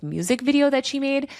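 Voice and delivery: ordinary talk, nothing marked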